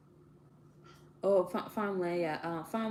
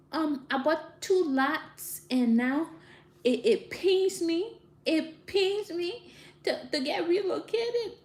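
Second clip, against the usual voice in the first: hammed-up tearful plea to the judge, rising higher, breaking into a fake cry; mock pained plea; 9 semitones higher, 4 dB louder, slower than usual. Pleading to the judge over relocation, hammed-up fake crying. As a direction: overact the hurt of losing the land. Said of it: "You see how bad my acting is?".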